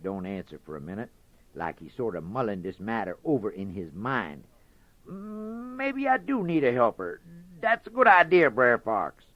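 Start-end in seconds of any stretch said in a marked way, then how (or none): 5.1-9.2 lightly higher, breezy rabbit voice; sly Brer Rabbit. Acted. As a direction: tease him, pretend it's fun.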